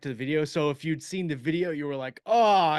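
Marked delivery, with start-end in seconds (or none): 2.2-2.8 sudden high, loud oh of realization; an imitated jolt of recognition; surprised oh. You were like, realizing callback.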